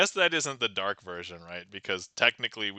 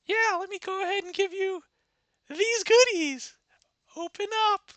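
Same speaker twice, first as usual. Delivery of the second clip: high girlish put-on voice; 5 dB louder than usual. Red feeding the wolf grandma, high sweet girl voice. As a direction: offer the treats like an eager little girl.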